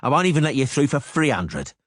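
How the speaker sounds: guard refuses the bribe, loud and menacing. Acted. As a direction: slam the door on the bribe. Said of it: "said menacingly".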